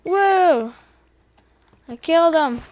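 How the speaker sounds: loud, high burst of triumph; excited exclamation. Real, not acted. Gamer just killed an enemy, loud astonished excitement. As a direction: exclaim at a surprise win. Wins a fight in the game.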